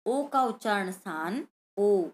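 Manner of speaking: ordinary delivery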